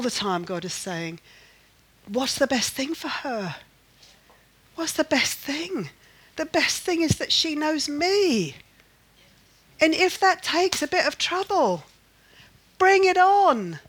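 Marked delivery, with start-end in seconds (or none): 2.1-11.8 raised pitch, building in force; emphatic challenge. Retold or imitated. God answering a worried grandmother, emphatic and building. 12.8-13.8 loud, high, drawn-out challenge; defiant call. Retold or imitated. God defying trouble for her sake, loud challenge. Climax of God's voiced challenge.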